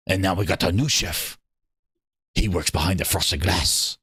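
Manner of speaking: low, deep voice of a showcase host giving a tour; mock showcase host. Streamer playing the showcase host, giving a kitchen tour in a deep voice. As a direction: play the smug host showing off the kitchen. Host introduces the new chef.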